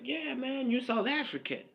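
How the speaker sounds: a hint of a teasing hip hop buddy; mock hip hop guy. Fan of hip hop culture teased by friends, lightly mocking. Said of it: "it's like".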